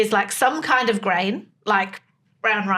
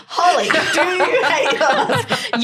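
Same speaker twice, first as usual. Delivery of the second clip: higher, drawn-out teasing question through laughter; laughing; 7 semitones higher, 4 dB louder, slower than usual. Real, not acted. Co-host teasing Holly about her marriage, laughing. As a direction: tease a friend with a slow, laughing question.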